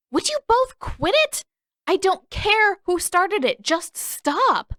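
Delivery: high, loud, fed-up shout; older sister yelling at the two boys to stop; exasperated yell. Acted. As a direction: yell at them to knock it off.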